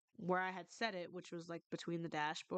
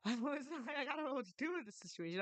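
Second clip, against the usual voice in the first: nervous laugh; 8 semitones higher, faster than usual. Retold or imitated. Awkward laughing at crying friend.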